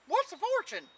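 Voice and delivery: high, loud hound dog voice; old hound character. Acted. Hound answers Jack in story.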